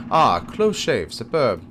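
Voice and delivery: put-on emphatic French-accented exclamation. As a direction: savor it slowly with a French accent. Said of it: "gave him the French accent".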